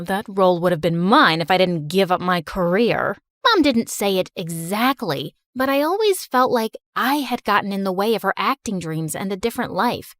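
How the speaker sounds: ordinary delivery, nothing marked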